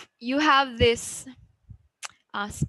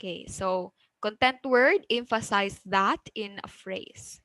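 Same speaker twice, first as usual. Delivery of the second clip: ordinary delivery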